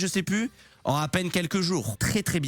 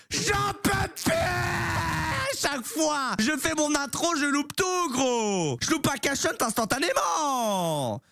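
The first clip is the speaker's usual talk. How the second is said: escalating comedy rant; 8 semitones higher, slower than usual. Real, not acted. Botches his intro again.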